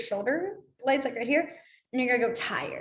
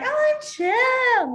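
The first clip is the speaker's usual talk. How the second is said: high, loud, drawn-out gushing; overexcited chill; 13 semitones higher, 6 dB louder, much slower than usual. Shown as a demonstration. A teacher mocking the wrong way to say chill, overexcited. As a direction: gush it like an overeager kid. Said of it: "No, it's".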